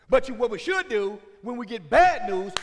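usual speaking voice

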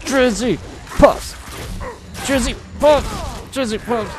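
high loud calling out. Real, not acted. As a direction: shout the name across the map. Calling out name repeatedly.

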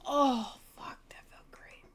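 breathless whispered relief; relieved whisper. Real, not acted. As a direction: let it all out in a whisper. Relief after beating the boss.

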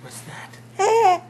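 squeaky delighted ask; high excited question. Real, not acted. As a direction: pitch way up with a laugh.

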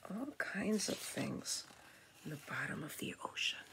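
whispery, soft aside; soft whispered marvel; hushed wonder. Real, not acted. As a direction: whisper it in quiet awe. Hushed wonder over picture book.

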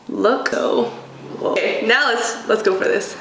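normal talking voice

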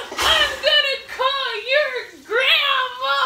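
high, loud, slow wail; mock crying shout. Daughter imitates mom threatening to phone grandma, wailing. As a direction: blubber it loudly, hugely overdramatic. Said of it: "and she's like".

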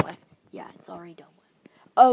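breathy aside whisper. Real, not acted. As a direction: whisper it to yourself. Aside muttered under breath.

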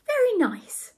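very high-pitched reply; Ethel's voice. A narrator's reading. Ethel approves of the scenery, high voice. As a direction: pitch it right up for the character.

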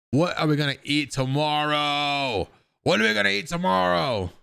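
playful, stretched-out question to everyone. Pretend. Playfully calling out a drawn-out question.

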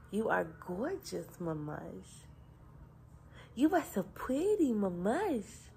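lilting coo with swooping pitch. Real, not acted. Mother adoring her baby.

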